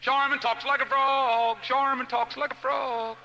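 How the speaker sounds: loud, high drawn-out taunt; singsong playground jeer, stretched out; kids teasing. Retold or imitated. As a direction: chant it slowly like mocking schoolkids. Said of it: "I watched the kids tease her".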